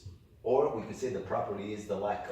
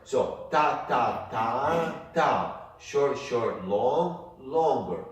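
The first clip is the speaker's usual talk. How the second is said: ta ta ta ta then short short long longer, each called out; rhythmic count, long vowel on longer; rhythm demo, louder; 4 semitones higher, 6 dB louder, much slower than usual. Shown as a demonstration. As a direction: spell out the rhythm emphatically. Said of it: "it's either long or short".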